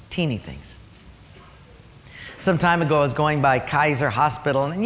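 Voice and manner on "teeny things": soft repeat. Shown as a demonstration.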